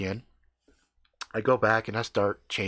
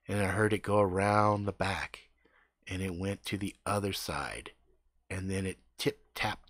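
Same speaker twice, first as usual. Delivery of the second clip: hushed spooky telling; 5 semitones lower, 6 dB quieter than usual. A narrator's reading. Telling creepy noises in hushed voice.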